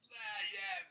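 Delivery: loud, high put-on street accent; mock gangsta accent. Mocking Clubhouse talkers who pile on accents, exaggerated gangsta accent. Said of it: "They wanna sound frickin' gangsta".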